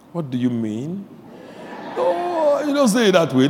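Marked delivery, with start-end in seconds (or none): none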